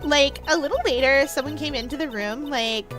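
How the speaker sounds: put-on drawling Valley Girl testimony; Valley Girl accent. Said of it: "the return of the Valley Girl accent".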